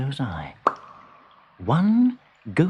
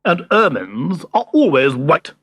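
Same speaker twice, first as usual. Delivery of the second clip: snapping ermine; 3 semitones higher, 5 dB louder than usual. Acted. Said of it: "he snapped".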